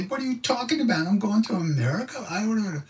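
a squeaky frightened other self; high panicky mock voice; panicked inner voice. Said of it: "But they'd say".

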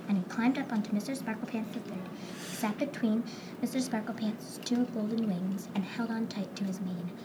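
ordinary delivery, nothing marked

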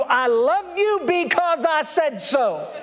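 put-on Father telling his child; fatherly declaration. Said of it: "when He says to you".

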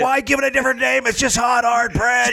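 high, fast yelling rant; screaming comic rant. Pretend. Joining the screaming comic bit.

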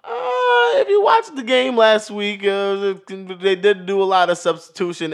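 high, drawn-out "uh" into loud protest; loud objection. Real, not acted. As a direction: jump in loud and high, then ease down. Objects loudly to co-host's dismissal.

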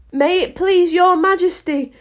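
high-pitched humble servant. A narrator's reading. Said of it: "in a very humble tone".

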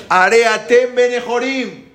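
high, loud, drawn-out cry; shouting. Acted. Moshe shows Pharaoh what to shout, shouting. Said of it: "Tienes que gritar".

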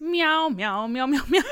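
high meowing cat voice. Acted. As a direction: meow like a cat. Repeats cat meowing bit.

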